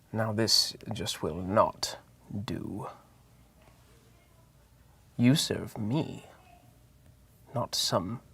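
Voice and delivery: soft, slow voice inside the mind; soft subtle voice. Acted. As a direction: speak softly and slowly, like a voice in someone's head. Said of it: "a very soft, subtle voice in your mind say".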